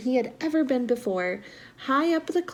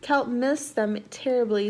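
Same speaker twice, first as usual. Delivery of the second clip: ordinary delivery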